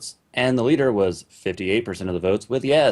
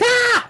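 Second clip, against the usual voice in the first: mock yell; 6 dB louder than usual. Pretend TeamSpeak jump-in scare.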